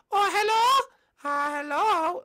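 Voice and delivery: put-on high falsetto girl voice; put-on high voice for a contestant; female contestant. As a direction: greet in a squeaky falsetto.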